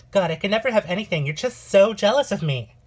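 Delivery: high, loud, fast whining rant; jealous outburst. Acted. Sister accusing her sibling of jealousy in a loud whine. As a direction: spit it out in a jealous fit. Sister fight over the fiancé.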